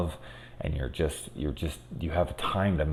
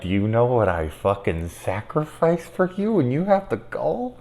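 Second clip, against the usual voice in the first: exasperated parent; 5 semitones higher than usual. Retold or imitated.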